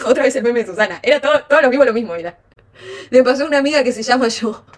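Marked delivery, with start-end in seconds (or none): none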